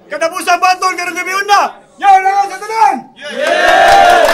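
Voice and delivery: loud crowd-pumping MC; hype host shouting. Acted. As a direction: pump up the crowd. Host hypes the rap battle crowd.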